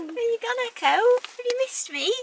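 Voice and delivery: squeaky pet-talk greeting; high cooing pet voice. Real, not acted. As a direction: coo at them like they're babies. Cooing at cat Echo.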